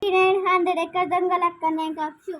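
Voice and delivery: loud end of count; calling out. Real, not acted. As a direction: shout the ready call. Seeker ends count loudly. (గట్టిగా అరుపు)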